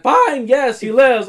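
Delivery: wife giving in loudly and high. Retold or imitated. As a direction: cave in with a loud high huff. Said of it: "She said".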